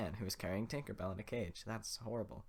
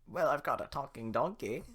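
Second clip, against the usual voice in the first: put-on old woman voice; 5 semitones higher, 7 dB louder than usual. Old woman offering donkey.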